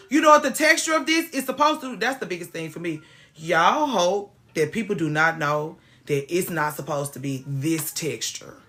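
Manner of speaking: usual speaking voice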